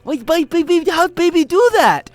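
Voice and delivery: put-on high, loud, slowing old lady broken English; grandma amazed by AI baby videos, high and halting; imitating grandma. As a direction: play a baffled grandmother squeaking her question. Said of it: "she's like".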